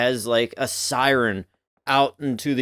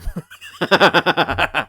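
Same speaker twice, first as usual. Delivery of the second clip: loud burst of laughter; 4 semitones higher, 4 dB louder than usual. Real, not acted. Laughs at own poop joke.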